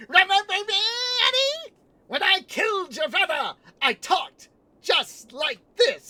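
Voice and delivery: high, squeaky, shouted villain voice; Judge Doom. Retold or imitated. Villain taunting Eddie about his father, high squeaky shriek. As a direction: screech it loudly, slowing down on the last words. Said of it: "higher and squeakier".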